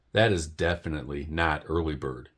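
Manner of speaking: usual speaking voice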